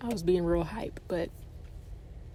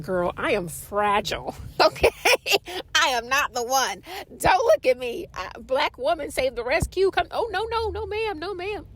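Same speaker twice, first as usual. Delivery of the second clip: high, hammed-up helpless pleading; mock fragile plea; 5 semitones higher than usual. Telling the staring receptionist to leave her out, mock-fragile. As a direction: play the scared bystander, half joking. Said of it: "And I'm like".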